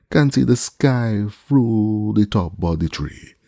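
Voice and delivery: high, slow call from far up; calling from the treetop. A narrator's reading. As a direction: call it down slowly. Jupiter calls from treetop.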